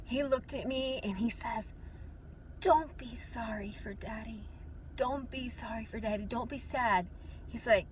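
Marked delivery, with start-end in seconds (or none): 2.6-7.1 hushed, gentle father's voice; tender reassurance. Retold or imitated. Dad in heaven consoles his daughter, tender and hushed. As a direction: softly soothe a grieving child.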